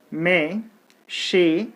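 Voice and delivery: "machinery" stretched slowly, beats apart; slow syllable count. Shown as a demonstration. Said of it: "there are four syllables".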